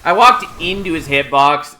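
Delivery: loud, high exasperated complaint. Real, not acted. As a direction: vent at your own mistake. Walked into hitbox.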